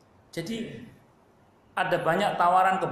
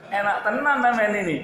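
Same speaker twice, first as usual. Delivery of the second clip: louder, higher, still laughing; 7 semitones higher, 5 dB louder than usual. Real, not acted. Preacher teases the old convert, laughing loudly. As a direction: push the words out through laughter.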